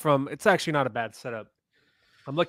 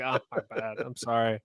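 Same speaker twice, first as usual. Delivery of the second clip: sheepish apology; 2 semitones lower, 8 dB quieter than usual. Retold or imitated. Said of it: "I was like".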